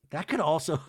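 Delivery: ordinary talk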